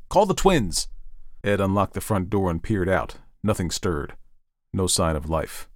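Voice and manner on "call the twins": high, urgent interrupting order; sharp command. Acted. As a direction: cut her off impatiently. Ed cuts off his wife.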